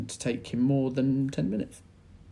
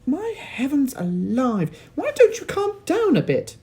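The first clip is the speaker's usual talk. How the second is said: exasperated Missus Cranky; 9 dB louder than usual. A narrator's reading. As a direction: tell him off, fed up. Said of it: "Missus Cranky said".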